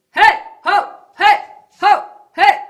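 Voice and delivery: short belly-driven hailing shouts; calling out. Shown as a demonstration. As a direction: punch out short separate calls. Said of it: "it goes really like this".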